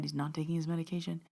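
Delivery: soft, quick message heard inwardly; inner voice. Retold or imitated. Medium hearing a message in her head, quiet inner voice. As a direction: keep it quiet, like a thought repeating. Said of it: "kept hearing in my head".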